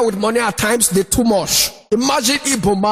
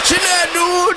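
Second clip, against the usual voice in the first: high long call for Chinedu; hammed-up call; 8 semitones higher, slower than usual. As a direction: shout for the child across water. Father calling child.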